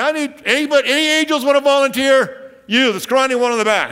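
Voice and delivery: hammed-up God calling out for a volunteer; playful God voice hollering to heaven. God voiced asking angels.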